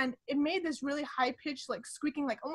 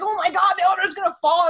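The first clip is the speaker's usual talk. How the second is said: mock panic; 5 semitones higher, 9 dB louder, faster than usual. Panicking in rickety elevator.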